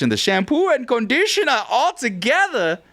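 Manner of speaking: high, singsong hint of German; a little German lilt, playfully put on; slight German accent. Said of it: "you went like a little German".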